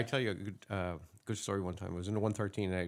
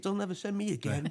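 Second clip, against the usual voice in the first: much higher-pitched indignant rant; pissed off; 10 semitones higher than usual. Acted. Older cop refusing to recite coffee orders, indignant. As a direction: act out an outraged older colleague, high voice. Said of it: "he's all pissed off".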